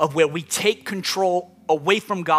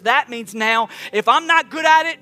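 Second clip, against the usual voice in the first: raised, urgent preaching voice; impassioned preaching; 7 semitones higher, 5 dB louder than usual. Real, not acted. Preacher urging people to hand control to God, impassioned preaching. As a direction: preach it higher, pressing on without letting up.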